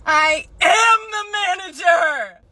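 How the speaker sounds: high, slow shout. Acted. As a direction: yell it out in frustration. Cashier shouting manager reveal.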